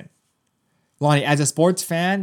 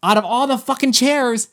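high-pitched, incredulous exasperation; exasperated outburst; 9 semitones higher, 4 dB louder than usual. Retold or imitated. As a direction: let the exasperation leap up in pitch. Exasperated outburst at climax of story.

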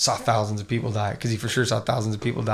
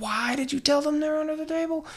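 high, squeaky mock reproach; aghast family; 4 dB quieter than usual. Family asking the sister why she told, aghast squeak. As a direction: scold her in a tiny high voice.

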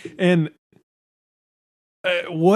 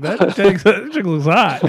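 laughing through it; 5 semitones higher than usual. Retold or imitated. Recalling kindergarten crush.

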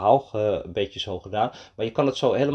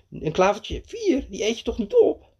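indignant disbelief; 11 semitones higher than usual. A narrator's reading. Mees objecting to the rabbit's snack, indignant disbelief. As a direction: sound appalled at the very idea.

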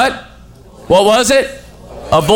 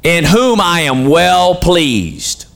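each word drawn out very slowly; slow, deliberate sermon emphasis; 2 semitones lower, much slower than usual. Real, not acted. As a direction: spell the phrase out slowly for weight. Preacher stressing repeated phrase.

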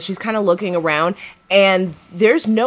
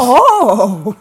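higher, louder put-on delight; fake excited; 5 semitones higher, 7 dB louder than usual. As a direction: coo with exaggerated fake excitement. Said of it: "so fake excited about it".

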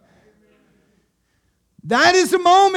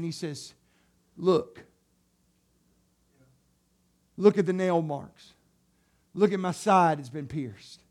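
lower, softer, slow reverent voice; hushed tender Jesus; soft reverent telling; 6 semitones lower, 6 dB quieter than usual. Acted. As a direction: tell it gently, full of awe. Quiet tender retelling.

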